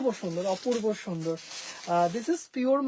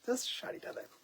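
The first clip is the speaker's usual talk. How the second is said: soft breathy whisper, much quieter; whispered aside; 13 dB quieter than usual. Real, not acted. To viewers while showing the saree, a whispered aside. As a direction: slip it in under the breath.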